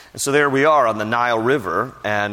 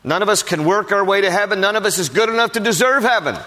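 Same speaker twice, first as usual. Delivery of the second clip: higher, pressing preacher's voice; preaching; 8 semitones higher, faster than usual. Acted. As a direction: drive the point home. Preaching the main point.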